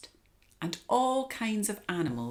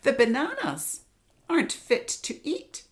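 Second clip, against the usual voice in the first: much higher, loud monkey voice; monkey chatter; 9 semitones higher, 7 dB louder than usual. Acted. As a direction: squeak it out like a cheeky monkey. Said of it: "chattered Monkey".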